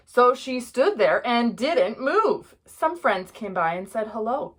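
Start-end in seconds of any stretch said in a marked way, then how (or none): none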